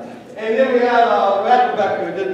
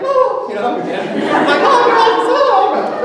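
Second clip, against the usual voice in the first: put-on squealing high bubbly girl, laughing; high giggly mock bubbly voice; 12 semitones higher, 4 dB louder, slower than usual. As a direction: gush like an excited bubbly new girl. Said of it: "real bubbly".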